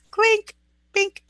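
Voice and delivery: squeaky little "cheers" sound effect; playful clink noise. Pretend. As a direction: chirp out a toy-like toast clink. Imitating cups clinking in a toast.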